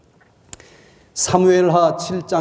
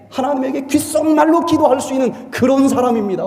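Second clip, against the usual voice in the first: high, loud, impassioned sermon voice; rising passionate proclamation; fervent preaching; 8 semitones higher, 5 dB louder than usual. Real, not acted. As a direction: preach it with full fervor. Preaching at full force.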